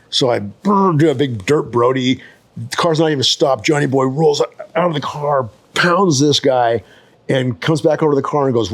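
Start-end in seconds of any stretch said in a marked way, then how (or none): none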